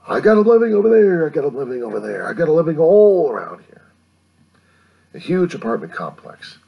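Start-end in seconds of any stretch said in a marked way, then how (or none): 0.1-3.8 loud, high-pitched outburst; worked-up, high exclaiming; raised-voice rant. Real, not acted. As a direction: call it out high and loud, sweeping round the neighborhood. Mock ranting about neighbors all around.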